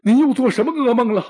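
high, loud, quick alarmed courtier; worried minister. Acted. Minister rushing to rouse the startled emperor, high and loud. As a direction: rush to wake a dreaming emperor.

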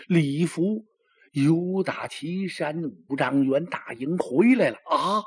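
plain reading voice

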